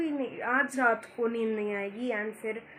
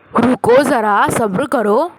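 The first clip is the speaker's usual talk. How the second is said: much louder, shout wait a moment; loud call; 16 dB louder, slower than usual. Real, not acted. Girl shouting to someone to wait and be patient, loud.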